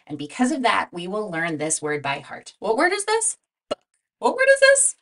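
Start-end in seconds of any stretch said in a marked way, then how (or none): 2.6-4.9 high, bright quiz call to the class; raised quizzing voice for the children; eager prompting. Shown as a demonstration. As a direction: call out the question to the whole class, then answer. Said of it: "teacher prompting class".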